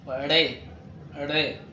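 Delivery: ري as a hard retroflex ڑے; hard Urdu r. Shown as a demonstration. Teacher models a letter absent in Arabic, hard retroflex ري. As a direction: say ري with a forceful flapped r, tongue curled back. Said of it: "بشدة".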